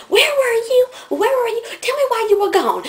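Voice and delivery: loud, very high squealed questions; mock clamouring kids. Classmates demanding where the latecomer was, mock clamouring.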